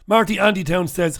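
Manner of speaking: normal talking voice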